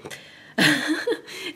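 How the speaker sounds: a high burst of laughter at her own blunder; sudden high giggle after admitting a goof; self-mocking laugh. Real, not acted. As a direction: laugh at yourself, loud and high.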